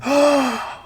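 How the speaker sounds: high sharp gasp of fake surprise; mock shocked gasp. Mimicking a model's staged gasp, high mock gasp. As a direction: gasp like a hand over the mouth. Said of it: "her hands on her mouth going".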